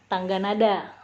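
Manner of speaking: normal talking voice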